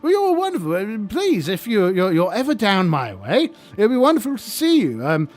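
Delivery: high, jolly lich host voice; chirpy undead host, eager to please; friendly lich. Acted. Lich greeting the young adventurers, high and chummy.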